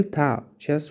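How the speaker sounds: ordinary talk, nothing marked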